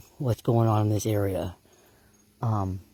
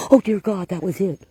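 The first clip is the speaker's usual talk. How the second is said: high, loud burst of real shock; startled exclaim; 8 semitones higher, 5 dB louder than usual. Real, not acted. Investigator hearing the vocal close by, startled high cry. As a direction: blurt it out, stunned.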